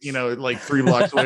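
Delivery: ordinary talk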